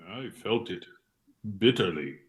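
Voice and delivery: ordinary talk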